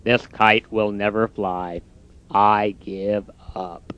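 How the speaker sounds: low, soft, slow defeated voice; glum Toad. Acted. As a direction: drag out the giving up. Toad's voice.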